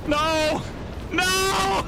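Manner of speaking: high, loud anguished cry; despairing shout. Real, not acted. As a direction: scream it in despair. Character falls, despair.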